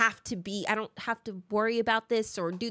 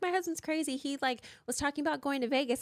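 lightly imitated Australian accent, higher and softer; 5 semitones higher, 6 dB quieter, faster than usual. Friend retelling Chris's remark about husband, Australian accent. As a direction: quote him in a soft, pitched-up Australian accent. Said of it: "in his Australian accent".

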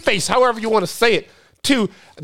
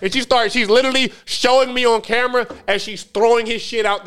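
ordinary delivery

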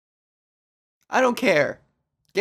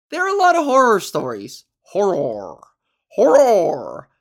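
1 spooky horror voice; 6 dB louder than usual. Pretend. Dramatic horror stories.

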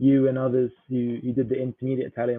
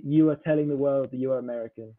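normal talking voice

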